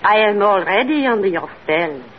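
put-on seductive French accent.

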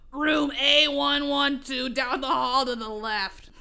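slow hammed-up raspy office lady; irritated receptionist. Reading her old story, voicing the grumpy school secretary slowly. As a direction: drag out each word like a bored clerk. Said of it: "in her irritated, raspy voice".

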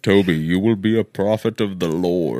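deep, menacing villain rumble; low movie-villain pronouncement; Bane voice. Acted. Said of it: "now I'm Bane".

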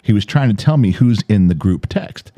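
usual speaking voice

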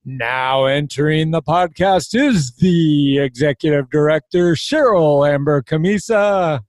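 booming circus ringmaster call. Acted. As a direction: announce it like the big top. Ringmaster-style podcast announcement.

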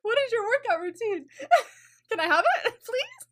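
hyped disbelief. Retold or imitated. Fan gushing to a friend about his waist, high and laughing. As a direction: gush over his body in laughing awe. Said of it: "I'm like".

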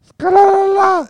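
very high, loud held note; high singsong echo. Pretend. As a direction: squeal the silly name on one long note. Echoes a silly reviewer name.

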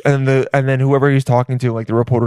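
ordinary delivery

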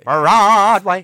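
loud theatrical Broadway call; Broadway showman. Pretend. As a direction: belt it like a stage star. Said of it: "I'm on Broadway".

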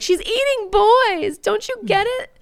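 very high, loud, slow panicked plea; frantic warning. Retold or imitated. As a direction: beg him to understand, desperate. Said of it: "she's like".

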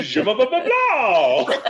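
giggly high fake French; imitating Joey's French, laughing; mock French. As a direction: laugh through a bad French accent. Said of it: "Like, like".

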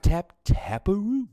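high, slow singsong silly voice; Adam Sandler. Retold or imitated. Co-host quoting the movie's putting line, goofy impression. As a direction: draw it out in playful goofy mimicry. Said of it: "Adam Sandler being Adam Sandler".

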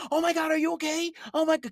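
mock-panicked mum rushing to a fallen kid; fussy parent. Mocking a parent who rushes to the fallen child, fussing and high.

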